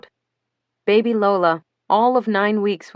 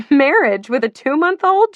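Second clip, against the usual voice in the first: high, loud incredulous shriek; a mock-outraged shriek at the group; 7 semitones higher, 6 dB louder than usual. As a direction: shriek it in disbelief. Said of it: "shrieked Melinda".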